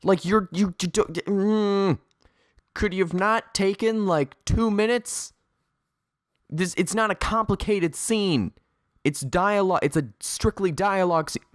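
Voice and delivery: high-pitched, sputtering disbelief; exasperated. Real, not acted. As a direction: rant in frustration, tripping over your words. Exasperated rant at a lazy retake.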